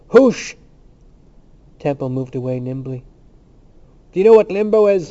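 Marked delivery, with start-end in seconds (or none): 0.1-0.6 high, loud hoosh, as if to a fowl; shooing cry. A narrator's reading. Cranley drives Temple away like a fowl, crying out. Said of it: "crying as if to a fowl". 4.1-5.1 high, loud challenge; crying out. A narrator's reading. Temple taunts the company about limbo, crying out. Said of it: "he cried".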